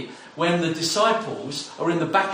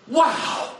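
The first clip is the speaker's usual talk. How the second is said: a sudden amazed wow, pitch jumping up; excited wow; 6 semitones higher than usual. Acted. Preacher marvelling at God's love: amazed wow. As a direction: let the wonder burst out. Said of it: "I have to shout this out".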